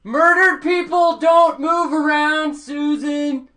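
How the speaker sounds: slow loud shout upstairs. Acted. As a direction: bellow it up the stairs.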